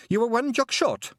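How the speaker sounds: high, loud Scots pixie voice, eager to please; a wee Scottish pixie chirping up to Tiffany; Feegle pixie voice. Acted. Pixie telling Tiffany his long name, high and eager.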